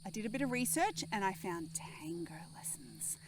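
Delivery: hushed secret; whispering. Real, not acted. Conspiratorial aside about tango.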